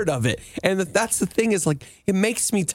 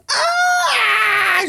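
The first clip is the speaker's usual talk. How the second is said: excited scream. Retold or imitated. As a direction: shriek like a winning card game hand. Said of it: "She starts yelling".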